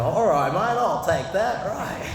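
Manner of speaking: high, exaggerated Australian Bogan accent. Pub drinker accepting a book, mock Bogan accent. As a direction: imitate an eager Bogan in broad Australian.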